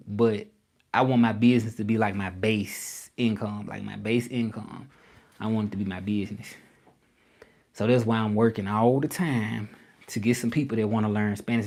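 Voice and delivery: normal talking voice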